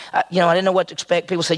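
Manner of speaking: usual speaking voice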